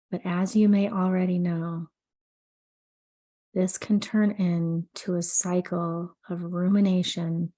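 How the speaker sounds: soothing sleep meditation read; guided meditation. Acted. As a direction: guide the listener gently into calm, unhurried. Leads an anxiety meditation.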